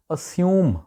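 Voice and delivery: "اسیوم" with crisp "s": uh-SYOOM; correct pronunciation. Shown as a demonstration. Teacher introduces the word assume, correct pronunciation. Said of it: "actual اس کا تلفظ ہے".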